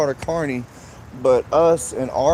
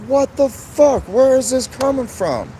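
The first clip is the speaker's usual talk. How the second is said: shocked and hurt; 8 semitones higher than usual. Retold or imitated. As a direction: cry out in disbelief at the sudden attack. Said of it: "I was like".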